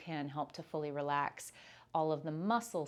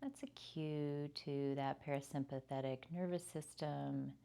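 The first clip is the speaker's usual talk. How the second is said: guided meditation. Acted. Leads a yoga nidra body scan.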